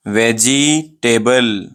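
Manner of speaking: "वेजिटेबल" very slowly split, VEH-JEE, TABLE; wrong pronunciation. Shown as a demonstration. Teacher repeats the common mistake, "वेजिटेबल" broken very slowly. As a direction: drag out "jee", then separate "table". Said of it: "ये wrong pronunciation है".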